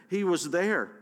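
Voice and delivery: a woman's loud cry from the crowd. A narrator's reading. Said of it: "cried one woman".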